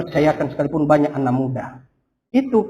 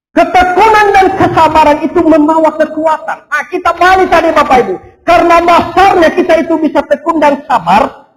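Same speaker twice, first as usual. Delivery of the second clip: loud, high, driving sermon voice; fervent preaching; 9 semitones higher, 8 dB louder than usual. Acted. Pastor urging the congregation, loud fervent preaching. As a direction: preach it out to the whole church.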